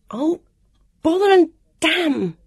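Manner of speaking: loud, high burst of frustration; vexed outburst, each word punched; exasperated cursing. A narrator's reading. Heroine curses after falling.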